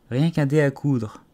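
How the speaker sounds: put-on understated little-girl sadness; sad reply. A narrator's reading. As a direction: answer slowly with a touch of regret. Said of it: "répondit-elle tristement".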